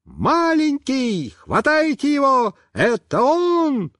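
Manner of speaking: put-on high, slow boy's yell; a boy in glasses shouting, high and stretched. A narrator's reading. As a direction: point him out, shouting. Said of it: "кричит".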